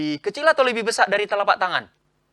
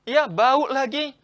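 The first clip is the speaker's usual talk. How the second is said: mock bully; 5 semitones higher, 5 dB louder than usual. Shown as a demonstration. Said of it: "Teman kita, kita bully".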